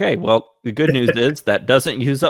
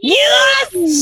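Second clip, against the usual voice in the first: excited cheer; 6 dB louder than usual. Real, not acted. Player cheers the dragon's roll.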